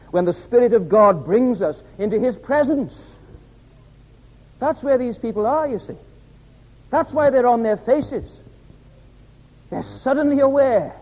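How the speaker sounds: high, ringing pulpit voice; fervent revival preacher; impassioned preaching. Acted. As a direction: lift it up and press each phrase on the congregation. Preacher at climax.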